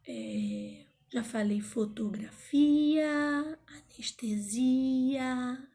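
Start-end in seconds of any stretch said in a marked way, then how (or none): 1.6-3.6 "fotografia" with a long high FI; "fotografia", stretched near the end; drawn-out stressed syllable. Shown as a demonstration. 3.7-5.7 "anestesia" with a long held SI; "anestesia", stretched near the end; drawn-out stressed syllable. Shown as a demonstration.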